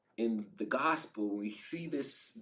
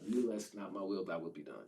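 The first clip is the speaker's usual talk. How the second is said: quiet surrender; 6 semitones lower, 6 dB quieter, faster than usual. Acted. Quiet surrender, inner authority.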